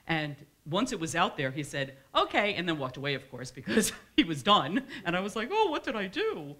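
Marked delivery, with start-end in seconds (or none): 2.1-2.5 offhand okay. Retold or imitated. Retelling son's brief reply. 5.5-6.5 high, loud mock dismay; mock panic. Author retells pushing the publish button, comic high-pitched panic.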